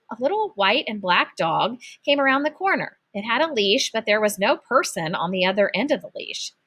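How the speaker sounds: ordinary delivery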